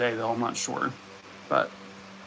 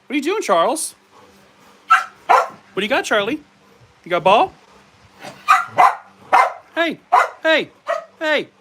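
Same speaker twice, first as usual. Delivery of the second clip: high excited coo, louder; pet talk; 11 semitones higher, 6 dB louder than usual. Pretend. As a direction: ask the dog playfully up high.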